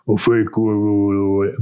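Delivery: fake low voice. Shown as a demonstration. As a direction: drop slightly into a put-on chest voice. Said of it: "that would be my fake low voice".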